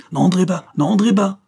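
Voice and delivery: sharp high cry to keep someone out; urgent cry of alarm. Acted. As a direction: shout to stop the door opening. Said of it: "s'écria monsieur".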